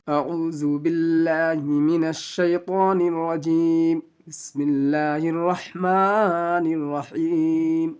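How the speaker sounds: slow, drawn-out chanted recitation; Quranic recitation. Acted. Teacher opening the Quran class, slow chanted recitation. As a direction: intone the opening formulas slowly and reverently.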